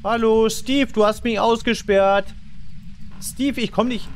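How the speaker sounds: calling out loud. Real, not acted. Locked out, calling ghost.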